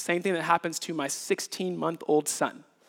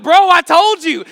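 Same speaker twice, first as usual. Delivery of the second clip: exasperated dad; 13 semitones higher, 18 dB louder than usual. Retold or imitated. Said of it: "I'm like".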